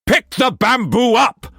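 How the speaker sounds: high, loud screamed order; screaming. A narrator's reading. Struan ordering the bamboo fetched, screamed order. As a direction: scream the command. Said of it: "he screamed as he finished".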